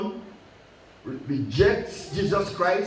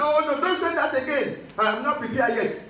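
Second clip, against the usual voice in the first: higher pitched, lightly hammed-up panic; a touch of retold fear; mock alarmed plea; 7 semitones higher than usual. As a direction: imitate a nervous friend begging you to stop. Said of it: "He said".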